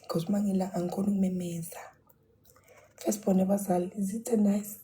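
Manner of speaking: whispering. Real, not acted. Whispered sign-off to stream.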